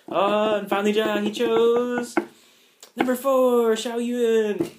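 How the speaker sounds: high, loud, drawn-out game show reveal; announcer reveal. Retold or imitated. Host announcing who he picked, with a loud slow build. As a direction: stretch it out like a host unveiling the winner.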